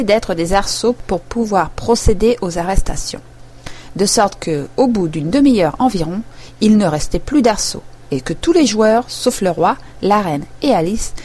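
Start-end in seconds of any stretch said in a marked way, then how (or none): none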